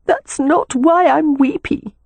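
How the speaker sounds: high, slow protest; weepy sister. A narrator's reading. Sister crying on brother's shoulder, weepy. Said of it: "blotting her wet cheek on his shoulder".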